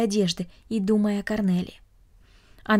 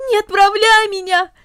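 high, louder sobbing wail; louder wailing plea; 11 semitones higher, 8 dB louder than usual. A narrator's reading. Cornelli wails again at her father, louder and tearful. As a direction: push the plea out harder, near a scream. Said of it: "запричитала она".

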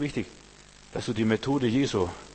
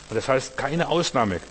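ordinary talk, nothing marked

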